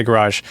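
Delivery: garage stressed on the second syllable; American garage. Shown as a demonstration. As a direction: say garage as guh-RAHZH. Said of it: "with my American accent".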